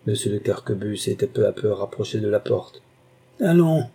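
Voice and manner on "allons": loud, high single-word command; angry cry. A narrator's reading. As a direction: burst out to drive him away. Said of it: "s'écria le marquis". (cri de colère)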